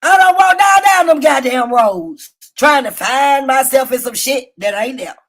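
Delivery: ordinary delivery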